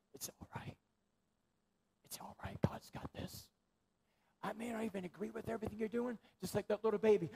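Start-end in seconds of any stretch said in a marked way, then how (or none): 0.1-6.2 hushed, gentle reassurance; soothing whisper. Shown as a demonstration. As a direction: whisper it to calm someone down. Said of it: "Your voice tone is nothing but a whisper".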